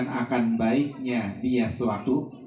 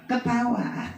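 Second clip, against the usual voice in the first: raised, emphatic sermon voice; fervent preaching; 10 semitones higher than usual. Acted. Preacher warning the funeral crowd, raised and emphatic. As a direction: drive the warning home to the mourners.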